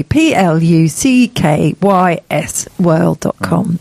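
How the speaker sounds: slow, careful dictation; slow spelling. Shown as a demonstration. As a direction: spell it out letter by letter, slowly. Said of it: "spelling out website slowly".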